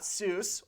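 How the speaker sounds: "Seuss" with no vowel after the final s; mispronounced SUSE. Shown as a demonstration. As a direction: say "Seuss" as one syllable, SOOSS. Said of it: "not Seuss or Susie".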